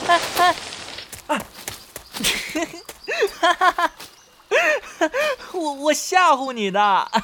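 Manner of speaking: teasing laugh after a fake stumble; playful teasing. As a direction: laugh as you admit the prank. Pretend fall then teasing.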